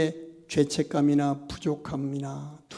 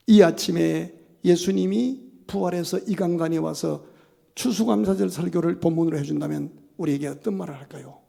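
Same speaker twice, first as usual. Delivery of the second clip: ordinary delivery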